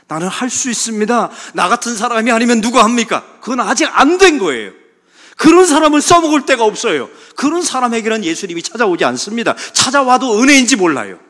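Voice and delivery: loud, high mocking boast; preacher thundering at pride; full-force preaching. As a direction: blast the proud self-reliance.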